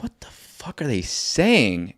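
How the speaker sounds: slow, whispered confusion; baffled whisper. Retold or imitated. Retelling overhearing crew, whispered puzzled aside. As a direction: whisper it to yourself, lost. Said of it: "I'm like".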